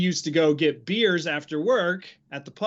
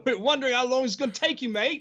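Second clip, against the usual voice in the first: teasing laughter; 8 semitones higher, faster than usual. Retold or imitated. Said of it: "the English guys were like".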